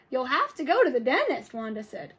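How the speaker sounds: lively read-aloud with character voices; storybook read-aloud for kids; reading to children. Acted. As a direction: read it to the class with expression.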